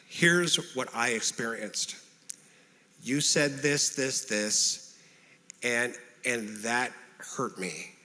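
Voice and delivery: slow, measured, with pauses; calm explanation. Acted. Explaining an injury to the one who caused it, calm. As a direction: calmly lay out the hurt, slowly. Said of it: "calmly explain the injury".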